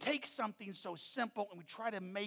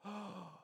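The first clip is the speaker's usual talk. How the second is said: soft imitated gasp of shock; hushed mock gasp from a child; retold gasp; 14 dB quieter than usual. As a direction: draw in a quiet, shocked "oh". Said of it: "she gasps. She goes".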